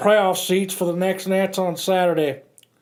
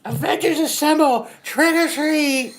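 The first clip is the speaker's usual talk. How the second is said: put-on excited kid call; 7 semitones higher than usual. Kids trick-or-treating.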